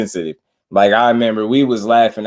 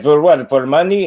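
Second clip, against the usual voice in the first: loud, higher mock Loma accent, baffled; imitating Loma's accent; 6 semitones higher, 5 dB louder than usual. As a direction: ham up Loma's broken English, louder. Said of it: "He said".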